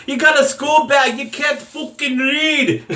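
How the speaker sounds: a mocking Irish taunt, laughing at the end; bad Irish accent. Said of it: "I don't do an Irish accent".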